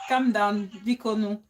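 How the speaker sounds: low, soft coaxing; quietly reassuring, easing off at the ends; soothing plea. Real, not acted. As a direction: gently beg a friend to calm down. Pleads with upset [name] to calm down.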